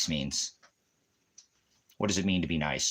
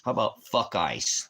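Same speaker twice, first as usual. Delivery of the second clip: ordinary talk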